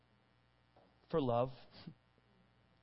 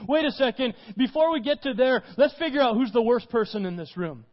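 louder, pointing the finger; mock accusing; 5 semitones higher, 5 dB louder than usual. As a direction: play the self-righteous crowd. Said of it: "start trying to point around and say".

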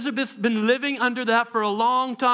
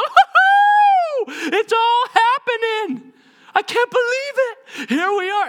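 high, loud, drawn-out celebration; whoop, then burst out in disbelief; overjoyed whooping; 11 semitones higher, 6 dB louder, slower than usual. Acted. Unborn John overjoyed in the womb.

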